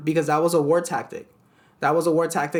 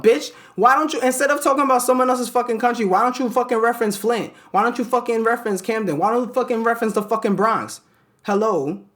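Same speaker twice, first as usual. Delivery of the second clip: angry rant; 8 semitones higher, 4 dB louder than usual. Real, not acted. Ranting at TV writers.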